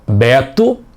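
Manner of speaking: ordinary delivery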